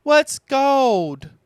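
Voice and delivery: put-on high, curious child voice; little Roo asking. Roo asking Owl what gold is, high and curious. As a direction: ask it like a wide-eyed little kid. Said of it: "Roo asked".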